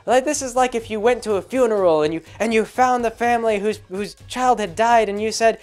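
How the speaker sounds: hammed-up how dare you; mock irate. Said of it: "Arthur Chu just gets irate".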